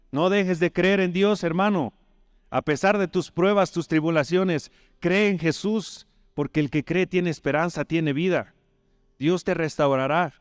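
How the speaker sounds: high, intense pulpit exhortation; fervent preaching. Acted. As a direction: plead with the congregation, voice lifted high. Preacher exhorts congregation at full force.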